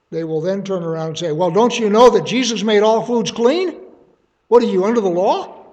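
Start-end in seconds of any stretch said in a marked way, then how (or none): 1.3-5.6 higher and louder, hammed-up accusing challenge; mock accusatory scolding. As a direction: play the smug Baptist catching him out. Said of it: "It has that kind of a flavor".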